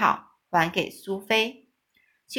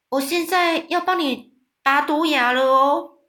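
loud announcement; 5 semitones higher, 5 dB louder than usual. Acted. Giant announcing fang pull.

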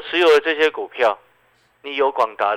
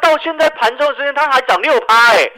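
louder and higher, thrilled about the gains; excited bragging; 10 semitones higher, 7 dB louder than usual. Real, not acted. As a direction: crow about doubling your money.

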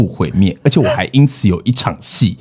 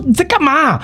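retold angry. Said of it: "然后就非常的生气".

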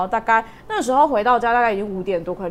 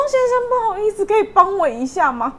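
high, sweet pleading plea to a stranger; pleading for help; 11 semitones higher, 5 dB louder than usual. Retold or imitated. She asks the taxi driver for help, high and pleading. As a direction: ask the favour in a high, polite, pleading voice.

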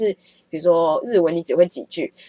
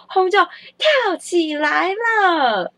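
high, very slow showman reveal; mock documentary announcer; dramatic announcement; 11 semitones higher, much slower than usual. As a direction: draw it out like a TV presenter. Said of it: "两个sales同时说出来".

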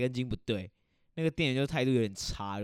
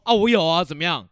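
mock defiant snap; 5 semitones higher, 10 dB louder than usual. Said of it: "我就只是说".

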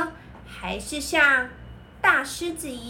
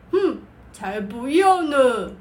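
louder, grumpy huff; rude bear voice; 5 dB louder than usual. Acted. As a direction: snub them with a scornful hmph. Bear refuses rabbit rudely.

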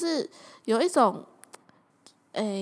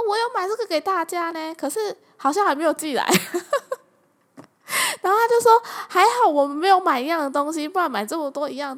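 higher, louder boss voice; 6 semitones higher, 4 dB louder than usual. Retold or imitated. Boss relieved they didn't buy the same gift. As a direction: sound pleased like the boss.

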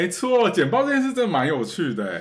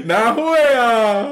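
voice cracking; 9 semitones higher, 8 dB louder, much slower than usual. Real, not acted. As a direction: voice breaks high on a laughing protest. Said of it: "又破音了。".

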